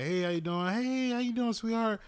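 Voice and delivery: chirpy, high friendly customer greeting; high, sugary older lady saying hello. Retold or imitated.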